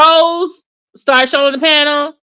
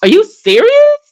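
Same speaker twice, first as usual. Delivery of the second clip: exasperated disbelief; 6 semitones higher than usual. Retold or imitated. To the panelist, high incredulous retort. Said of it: "I was just like".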